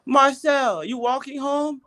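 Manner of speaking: high-pitched imitation of Indonesian coworker; high, inquiring coworker voice. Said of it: "she came to me, said".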